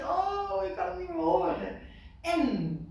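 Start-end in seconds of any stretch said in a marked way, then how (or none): 0.0-1.8 exasperated. Retold or imitated. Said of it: "ik zeg".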